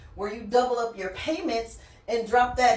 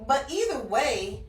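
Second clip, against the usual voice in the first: ordinary talk, nothing marked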